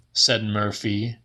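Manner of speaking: plain reading voice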